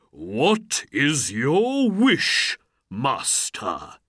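slow, booming genie question; loud, drawn-out genie greeting. A narrator's reading. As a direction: offer service grandly, swelling on the wish. Genie of the ring speaks.

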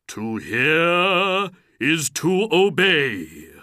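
slow, booming, high-swelling obedience; loud, drawn-out magical pronouncement; genie voice. A narrator's reading. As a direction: intone it grandly as a genie. Genie obeys his master.

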